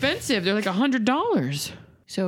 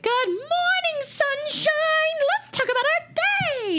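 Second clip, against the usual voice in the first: chirpy mother. Retold or imitated. Daughter mimics her mother's morning greeting, exaggeratedly chirpy. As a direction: imitate an overly cheery morning mom, way up high. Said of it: "my mother who was like".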